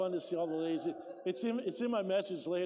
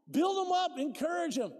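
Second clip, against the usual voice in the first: louder, higher preaching voice, building hard; fired-up preaching; 8 semitones higher, 4 dB louder than usual. Acted. Pastor urging the church to encourage each other, preaching loud. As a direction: preach it out with full conviction. Preaching at full force to congregation.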